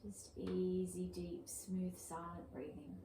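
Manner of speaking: calm, even yoga breath cues with long pauses; soothing, unhurried meditation-teacher voice; guided breathing meditation. Acted. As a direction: guide the breath gently, leaving space between phrases.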